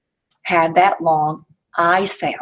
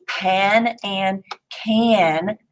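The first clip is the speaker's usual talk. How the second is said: pan and can drawn out very slowly; slow emphasis; much slower than usual. Shown as a demonstration. Teacher to class, slowly stretching the rhyming words. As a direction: stretch each word so the ah sound is clear. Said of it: "both have the ah sound".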